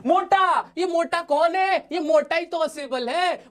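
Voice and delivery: high, slow exclaiming at the fat spy; playfully astonished, pitched high; mock surprise at a chubby spy; mock amazed. Teacher exclaims at fat spy picture.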